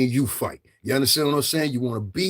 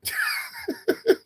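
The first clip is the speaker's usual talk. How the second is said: squeaky laugh. Real, not acted. Laughs mid-quote.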